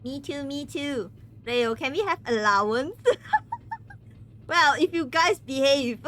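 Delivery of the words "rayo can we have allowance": normal talking voice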